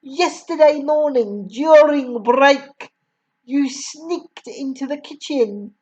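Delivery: raging headmistress. A narrator's reading. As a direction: rant like a furious tyrant. Headmistress rants at the boy.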